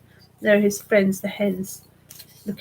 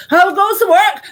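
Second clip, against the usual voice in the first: loud high startled call; woken farmer calling; 11 semitones higher, 12 dB louder than usual. Acted. Farmer woken by rocking bed, calling out loud. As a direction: shout it as he's jolted awake. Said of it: "he called".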